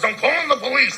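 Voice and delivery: shrill mock of a scared man; mock panicked cry. Mocking the beaten stepfather threatening police, loud high mock cry. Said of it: "And he goes".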